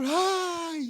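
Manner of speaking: high mock wail «¡Ay!»; mock lament cry. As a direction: sigh it out like a grieving mourner. Mourners' grief cry acted out. (lamento fingido y agudo)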